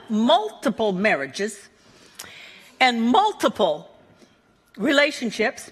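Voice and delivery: usual speaking voice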